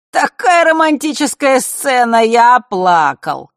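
mocking, rising high and loud. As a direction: sneer at her romance. Mark mocking her romance.

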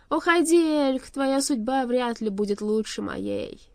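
loud high cry sinking into slow despair; anguished dismissal. A narrator's reading. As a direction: send him away in anguish. Doomed king dismisses his servant.

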